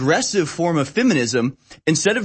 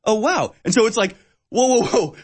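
higher, startled oh wow and whoa; surprised; 6 semitones higher than usual. Retold or imitated. Reacting to the woman's good question, surprised whoa. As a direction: caught off guard, voice jumping up. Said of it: "surprised, I'm like".

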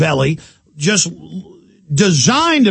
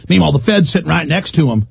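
usual speaking voice

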